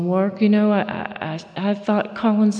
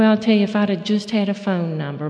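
ordinary talk, nothing marked

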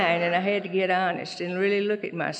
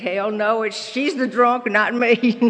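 a mild mock of a furious husband, louder and higher; indignant denial; 4 semitones higher, 6 dB louder, slower than usual. Husband retold denying he is sick, a mild indignant shout. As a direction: toss it off as the outraged husband. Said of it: "and he said".

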